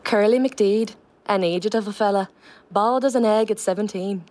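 line read again in a Donegal lilt; Donegal accent. Shown as a demonstration. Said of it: "Just give us it again".

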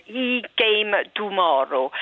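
He came tomorrow in Italian style, slow, every vowel pure. Shown as a demonstration. Coach contrasting Italian pure vowels with English. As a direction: give each vowel in He came tomorrow full Italian colour. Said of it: "whereas in Italian".

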